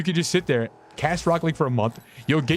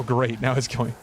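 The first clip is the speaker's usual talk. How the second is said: normal talking voice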